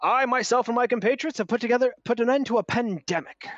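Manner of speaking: lightly voiced pompous adventurer; hearty boast. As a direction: boast grandly of deeds. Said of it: "he points at you and goes".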